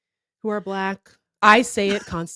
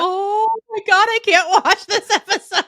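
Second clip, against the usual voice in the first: high, wailing mock dismay; mock agonized cringe; 8 semitones higher than usual. Reacting to the terrible accent, mock agonized cringe. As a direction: wail it like it hurts to watch. Voicing own cringe at episode.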